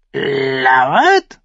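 high, loud screech of a raven. A narrator's reading. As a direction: shriek the word like a crow. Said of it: "kreischte der Rabe".

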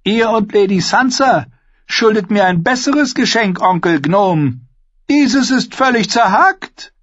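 high-pitched imperious demand; demanding boy king. A narrator's reading. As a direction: spoilt royal complaint.

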